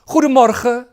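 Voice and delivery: Goedemorgen high and loud with a rising end and throaty g; loud cheerful Goedemorgen demo; teacher demo. Shown as a demonstration. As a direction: model Goedemorgen brightly for repeating. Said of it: "please repeat after me".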